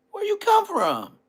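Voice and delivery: put-on high falsetto boy, falling at the end; little kid voice. Boy in the neighborhood questioning Bosco, high kid voice. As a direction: ask it in a child's unbroken voice. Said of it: "Balls was not dropped when that was going on".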